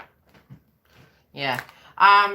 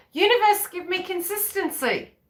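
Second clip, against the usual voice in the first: mock plea to universe; 10 semitones higher than usual. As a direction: cry out to the sky as the partner. Partner pleading to the universe.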